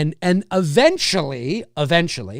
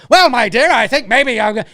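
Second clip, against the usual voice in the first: put-on high, loud, theatrical old-Hollywood delivery; over-the-top stage acting; 6 semitones higher, 7 dB louder than usual. Showing what's too big for the camera, hammed-up theatrical voice. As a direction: ham it up like a stage actor. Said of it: "you can't go".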